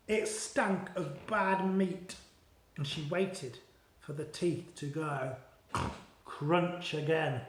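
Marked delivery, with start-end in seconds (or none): none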